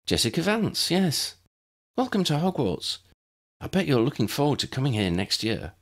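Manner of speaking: put-on a chatty girl welcoming a newcomer; friendly first-year Jessica. A narrator's reading. Jessica introduces herself to Lydia, friendly and bright.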